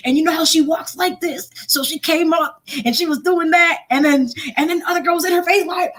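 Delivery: playful mock of two girls squaring off; imitating schoolgirls. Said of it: "imitate the people".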